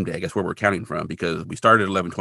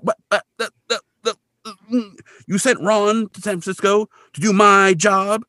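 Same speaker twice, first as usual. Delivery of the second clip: stammering, high-pitched indignation; sputter, then protest high in disbelief; mock outraged disbelief; 11 semitones higher, 3 dB louder than usual. Kolchak outraged Ron got his story.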